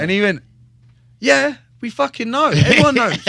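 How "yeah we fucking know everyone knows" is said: retold loud, high-pitched exasperation; exasperated reply. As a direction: shout it like it's old news. Friend impatient at old news.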